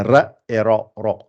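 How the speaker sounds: ra light, then ra dark and rounded; ra said light then heavy. Shown as a demonstration. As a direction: contrast a thin ra with a full ra. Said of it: "gonflée ... pas gonflée".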